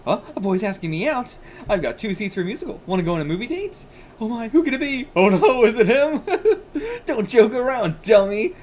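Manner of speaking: put-on high, loud giddy girl; excited girl. Girl reading a date note, high giddy voice. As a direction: gush about the date, then scold.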